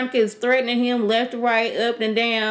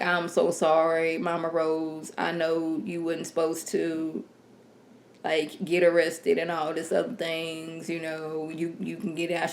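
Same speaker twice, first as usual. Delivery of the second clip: lower, softer, apologetic officer; meek apology; 4 semitones lower, 7 dB quieter than usual. Retold or imitated. Officer apologizing to Mama Rose, meek and soft. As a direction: grovel quietly to the matriarch. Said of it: "one officer comes in like".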